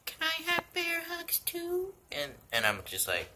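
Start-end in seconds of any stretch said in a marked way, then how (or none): 0.1-1.9 very high, small begging voice; high cute puppy plea. Retold or imitated. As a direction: squeak like a tiny puppy asking. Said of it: "the puppy's like".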